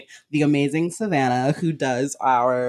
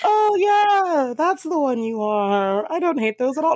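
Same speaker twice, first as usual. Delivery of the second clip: high, loud, overly bright fake cheer; fake enthusiasm; 12 semitones higher, 3 dB louder, slower than usual. Caught pretending to like Scorpios, high fake gushing. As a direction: gush over it to cover yourself. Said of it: "you caught me trying to be like".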